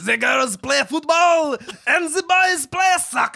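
slow, shouted fake French accent; mock French accent. Same sentence read as a challenge, in hammed-up French accent. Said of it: "read the same sentence in French".

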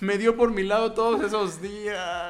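laughing. Real, not acted. Laughing at dad story.

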